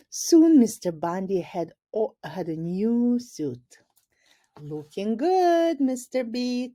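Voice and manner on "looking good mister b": higher, cheerful shout; called from the back. A narrator's reading. Roger in the back row calling to the principal, cheerful call. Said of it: "called Roger from the back row".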